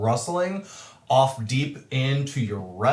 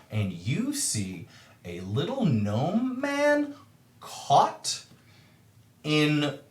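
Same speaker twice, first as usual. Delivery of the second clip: usual speaking voice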